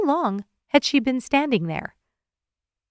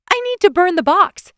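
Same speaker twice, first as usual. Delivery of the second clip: much higher and loud; desperate outburst; 10 semitones higher, 7 dB louder than usual. A narrator's reading. Woman declares she must burn the box, high and loud. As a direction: blurt it out near tears.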